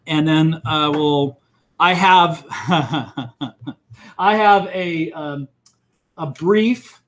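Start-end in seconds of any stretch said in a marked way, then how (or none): 2.5-3.7 low chuckle in a string of pulses; short run of low laughter. Real, not acted. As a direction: chuckle to yourself mid-sentence. Teacher amused while announcing quiz.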